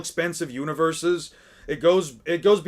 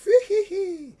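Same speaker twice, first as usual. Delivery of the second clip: high burst of laughter; 4 dB louder than usual. Real, not acted. Reactor laughs.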